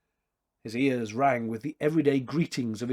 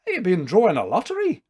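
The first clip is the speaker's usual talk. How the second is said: Scottish tailor; 7 semitones higher, 7 dB louder, faster than usual. Acted. Maclachlan voiced asking.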